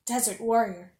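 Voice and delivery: ordinary delivery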